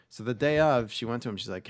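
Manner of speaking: ordinary talk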